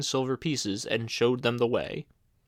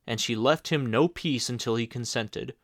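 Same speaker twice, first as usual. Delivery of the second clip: plain reading voice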